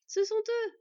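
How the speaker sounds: high, loud cry of joy; excited exclamation. A narrator's reading. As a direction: call out in excitement at spotting them. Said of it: "s'exclama finalement Skad".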